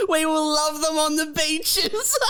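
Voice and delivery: slow, lofty wartime-speech parody with a laugh; mock grand speech. Friend parodying a soppy anniversary vow, hammed-up orator. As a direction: ham up a stirring oration, laughing.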